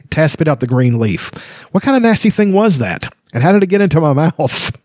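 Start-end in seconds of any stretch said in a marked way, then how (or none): 1.7-4.7 high, squeaky kender asking in disgust. Acted. Kender waking to a nasty taste, high disgusted voice. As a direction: spit it out like a puzzled, grossed-out little kender.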